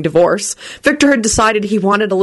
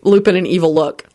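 usual speaking voice

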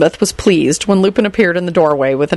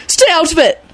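higher, angry cry; angry shout; 12 semitones higher than usual. A narrator's reading. Neville telling Lupin to stay out, angry shout. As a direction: snap at him to back off. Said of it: "Neville replied angrily".

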